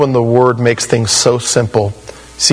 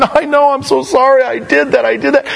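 much higher, pleading mock apology; whiny mock contrition; 10 semitones higher than usual. Preacher mimics people apologizing for their sin, whiny. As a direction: whimper it like a caught sinner. Mocking sorry sinners.

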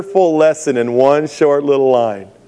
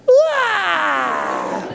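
put-on relieved child's cry. Lost child sees his mom.

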